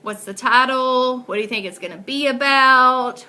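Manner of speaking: usual speaking voice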